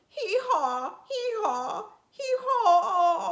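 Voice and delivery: high, loud braying with a sob; a donkey sobbing hee-haws; crying donkey. A narrator's reading. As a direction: bray like a weeping old donkey. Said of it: "he saw a donkey crying".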